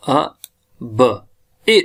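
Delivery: अ, ब, इ as separate sounds with gaps; sounded out. Shown as a demonstration. Teacher breaks the word down for learners, sounding out अ, ब, इ. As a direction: sound out अ, then ब, then इ one by one. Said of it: "ये sound दे रहा है".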